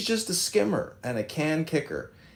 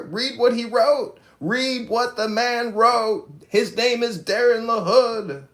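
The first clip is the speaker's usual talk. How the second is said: loud, high, slow declaration; raised-voice, drawn-out indignant demand; emphatic rant; 9 semitones higher, 5 dB louder, slower than usual. Real, not acted. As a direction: hammer out each word at the top of the rant.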